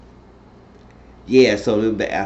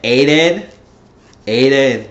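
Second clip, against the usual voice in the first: louder, calling his name to stop him; mock reproachful call to Aiden; retold scolding; 3 semitones higher, 7 dB louder, slower than usual.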